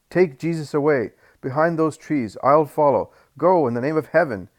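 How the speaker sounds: loud, high urgent shout. Acted. Judas shouts to John and Simon under stones, urgent and loud. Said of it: "he shouts to John and Simon".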